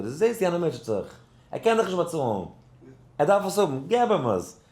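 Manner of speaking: high-pitched, forceful urging; urgent retold insistence. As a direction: act out the Rebbe pressing his point. Rebbe urging giving, acted.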